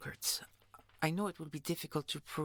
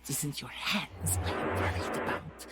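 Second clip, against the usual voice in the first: normal talking voice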